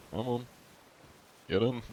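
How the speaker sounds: usual speaking voice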